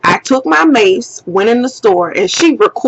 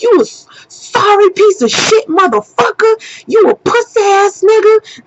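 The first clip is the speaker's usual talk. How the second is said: high-pitched angry cursing out; going off; 10 semitones higher than usual. Retold or imitated. Woman cursing the man out on camera, going off. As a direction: tear into him with high, heated insults. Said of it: "I'm just going the fuck off".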